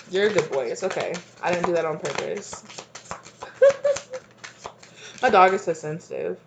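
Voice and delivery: usual speaking voice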